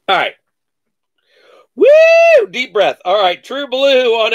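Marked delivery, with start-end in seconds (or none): 1.7-2.5 a loud, high whoop of joy; high, loud victory whoop; excited whoop. Real, not acted. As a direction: let out a big celebratory whoop. Celebrates after the hushed run.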